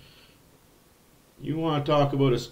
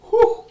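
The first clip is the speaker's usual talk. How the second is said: impressed whistle-like whew; 5 dB louder than usual. Real, not acted. Reacting to assist total.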